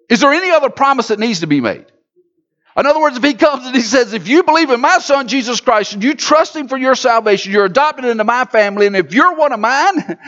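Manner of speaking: high, loud preacher's cadence; fervent sermon delivery; full-force preaching. Acted. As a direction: preach it out to the whole room. Pastor preaching to congregation.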